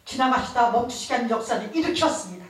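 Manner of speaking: building fervent preacher delivery; fervent preaching. Acted. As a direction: preach it to the congregation with conviction. Preaching at full force.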